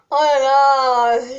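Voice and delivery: long exasperated groan; exasperated sigh. Real, not acted. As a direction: let it out slowly in exasperation. Struggling with a braid.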